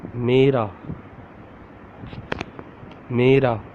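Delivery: Mera repeated carefully, "meh-ra"; Urdu word. Shown as a demonstration. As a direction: model Mera for learners, meh-ra. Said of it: "It becomes mera".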